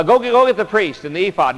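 high, loud panicked command; frantic order. Retold or imitated. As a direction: shout it in a flustered rush. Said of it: "He's just frantic and frenetic".

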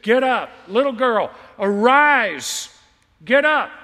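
loud, high command to rise; commanding call. Acted. Jesus calling the dead girl to rise, ringing loud command. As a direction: call her up from death with full force.

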